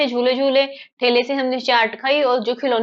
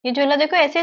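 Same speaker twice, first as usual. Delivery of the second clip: ordinary talk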